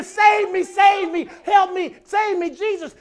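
loud high desperate pleas; screaming crowd. Retold or imitated. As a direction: cry out to be saved like the crowd. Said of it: "They were screaming".

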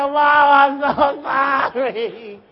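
loud, drawn-out mock crying; hammed-up wailing. Son apologizing to mama, exaggerated loud sobbing wail. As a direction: bawl the apology like a boy, stretching every word.

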